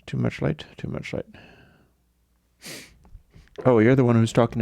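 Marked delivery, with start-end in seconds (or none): 0.0-0.6 grumbling softly at the morning light; muttered. Real, not acted. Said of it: "not liking the coldness of the light". 0.8-1.2 much softer repeat, trailing off; fading grumble to no one; softer mutter. Real, not acted. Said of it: "not liking the coldness of the light".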